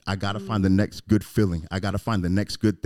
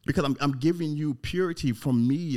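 ordinary delivery

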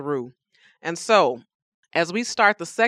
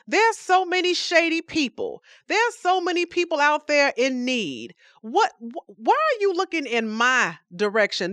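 high, loud exasperated outburst at God; a fed-up man yelling at the sky; exasperated Job; 9 semitones higher, 3 dB louder than usual. Acted. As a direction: throw it up at heaven, fed up. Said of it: "I'm angry".

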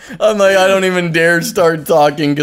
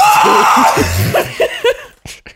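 screaming laugh. Shown as a demonstration. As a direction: let out a shrieking laugh. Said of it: "Like that".

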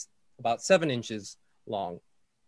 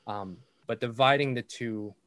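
usual speaking voice

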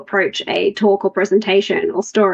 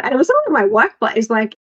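normal talking voice

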